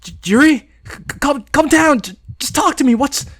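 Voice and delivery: high, loud, stuttering alarm; frightened friend stammering on the phone; stammering panic. Acted. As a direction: trip over the words in fear.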